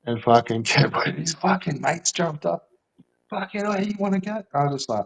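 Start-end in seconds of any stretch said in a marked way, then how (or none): 3.3-4.4 high, fast mock taunt; retold challenge. Junkie's mate squaring up to the speaker, high fast mock taunt. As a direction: rush it like a riled-up mate picking a fight.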